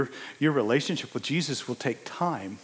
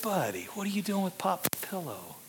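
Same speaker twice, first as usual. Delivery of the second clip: tender question; 6 semitones higher, 5 dB quieter than usual. Retold or imitated. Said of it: "I said".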